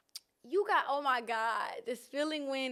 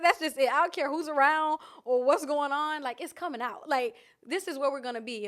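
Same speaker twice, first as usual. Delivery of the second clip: ordinary talk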